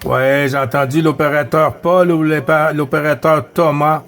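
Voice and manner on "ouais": normal talking voice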